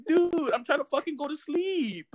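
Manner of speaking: high-pitched exasperated mock complaint; exasperated protest. Kept-awake man retells protesting to his roommate, high exasperated complaint.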